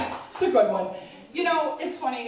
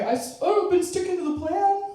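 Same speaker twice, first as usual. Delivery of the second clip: much higher, innocent sing-song excuse; sheepish patient; 6 semitones higher than usual. Retold or imitated. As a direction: play dumb about the diet. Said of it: "they always say the same thing".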